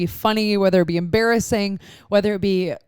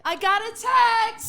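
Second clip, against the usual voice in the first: high, drawn-out but held-back squeal; attempted scream; 14 semitones higher, much slower than usual. Reluctant co-host trying the text announcement, half-hearted scream. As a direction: try screaming it without really yelling. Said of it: "you have to scream it".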